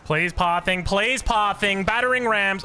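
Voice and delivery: high, drawn-out frustrated plea; exasperated pleading. Real, not acted. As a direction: beg loudly as it all goes wrong. Begging the game's units to move.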